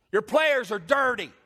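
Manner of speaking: loud, high, drawn-out accusation; exaggerated yell. Preacher replays confronting rival team's staff, yelling. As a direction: yell it slowly so the back row hears. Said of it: "I didn't yell it like that".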